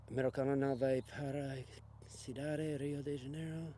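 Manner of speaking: sounding out "Americano Nave para Cidade, Rio de Janeiro" quietly, unsure of each word; reading Portuguese haltingly. Real, not acted. Reading a viewer's Portuguese comment aloud, quiet and unsure. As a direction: stumble softly through "Rio de Janeiro" as if reading it off a screen. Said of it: "I don't speak Portuguese".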